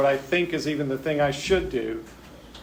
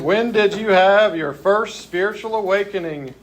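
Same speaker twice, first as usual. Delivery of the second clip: raised, emphatic reading of a question; hammed-up insistence, slowing on the last words; mock demanding; 5 semitones higher, 3 dB louder, slower than usual. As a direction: read it out like a pushy demand, dragging the end. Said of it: "Demanding, huh?".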